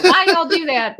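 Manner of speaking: heavier West Texas twang, high and loud, laughing. Retold or imitated. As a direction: lay the West Texas accent on thick. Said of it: "the West Texas accent coming out".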